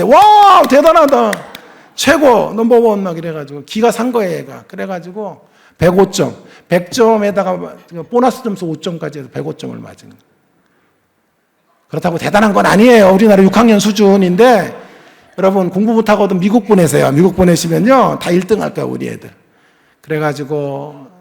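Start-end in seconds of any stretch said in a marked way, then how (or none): 0.0-3.0 much higher, louder cheer fading down; cheering kids. Retold or imitated. American classmates cheering the son, high and loud. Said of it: "미국 애들이 박수 친대". 11.9-19.3 louder, faster punchline to the congregation; forceful preaching. Real, not acted. Preacher telling the congregation to send kids to America, loud.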